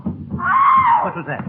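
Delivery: high, slow, frightened shout; startled shout. Real, not acted. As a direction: cry out in sudden alarm. Startled by a crash outside.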